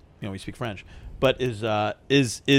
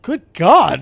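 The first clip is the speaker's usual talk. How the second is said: loud and higher, caught off guard; shocked exclamation; 6 semitones higher, 10 dB louder than usual. Retold or imitated. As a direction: blurt it out in disbelief. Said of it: "and I was like".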